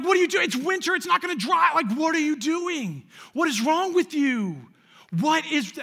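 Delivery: high-pitched, incredulous mock yell at a friend; exasperated yelling. Teen yelling at buddy over ice in car, high exasperated mock yell. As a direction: replay the outrage of a teenager watching ice hit the seats.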